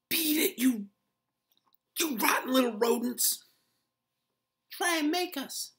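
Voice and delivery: high, loud furious squeak; squeaking shout. A narrator's reading. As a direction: squeak it at the top of your voice. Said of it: "squeaked at the top of his voice".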